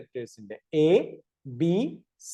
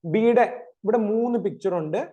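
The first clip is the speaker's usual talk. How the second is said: ordinary talk, nothing marked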